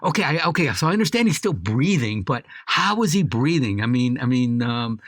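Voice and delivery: high, loud hammed-up bedside questioning; mock eagerness. Playing someone in line to pull the plug, mock eager. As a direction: play someone far too keen, barely hiding it. Acts out an overeager plug-puller.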